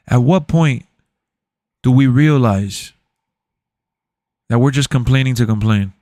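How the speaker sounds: very low, mock-serious bass voice. Streamer dropping his voice low to mock a viewer's complaint.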